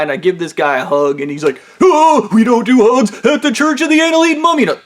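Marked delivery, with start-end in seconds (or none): 1.8-4.6 high, loud mock protest; hammed-up flustered refusal in a put-on voice; indignant manager. Manager refuses a hug from his worker, indignant protest.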